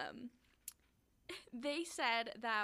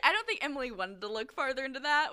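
words pushed out through laughter, louder; laughing; 3 semitones higher, 13 dB louder than usual. Real, not acted. Host explaining Emily's research, laughing through it.

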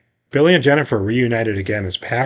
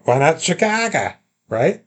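put-on higher-pitched Chicago accent, broad vowels; mock Chicago accent; 6 semitones higher than usual. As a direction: lean into a hammed-up Chicago accent.

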